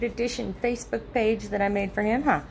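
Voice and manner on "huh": ordinary talk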